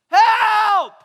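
long, high, full-force yell; shouting out. Acted. Lost and dying world calling out, shouted cry. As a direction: cry out for help at the top of your voice. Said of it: "who's still shouting out".

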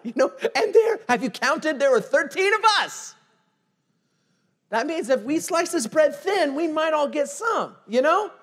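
loud, high preaching push; fired-up preacher calling out his listeners; full-force preaching. Acted. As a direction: drive the point home to the congregation.

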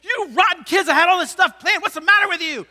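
loud, high angry yell; hammed-up angry father shout; furious dad. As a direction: yell at the kids for ruining the plan. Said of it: "what ends up happening is".

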